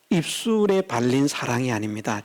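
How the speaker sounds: ordinary delivery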